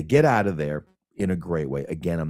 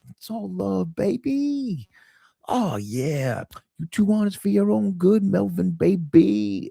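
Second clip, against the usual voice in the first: high, smooth sweet-talking voice; imitating Melvin; 13 semitones higher than usual. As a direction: coo it like a charming smooth talker. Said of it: "this is what he does throughout".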